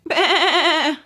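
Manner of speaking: high, loud bleating baa; sheep bleat. Acted. Sheep calling from the hillside, loud bleat. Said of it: "a sheep called".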